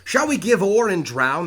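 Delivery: loud, high, slow exasperated yell; shouting at passengers. Acted. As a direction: yell it at the nobles in your way. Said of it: "So he's calling, uh, at these people".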